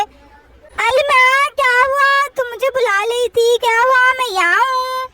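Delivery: put-on babyish lisping child voice; lisped, eager little-kid questions; little girl Chutki. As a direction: play a small daughter answering mom's call.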